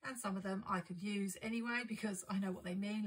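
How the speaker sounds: normal talking voice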